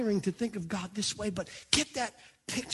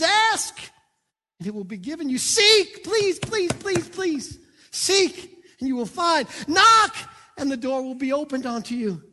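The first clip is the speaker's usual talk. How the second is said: preached scripture; 2 semitones higher, 6 dB louder than usual. Acted. Preacher pleading scripture to the church. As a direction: call out each verb like a plea.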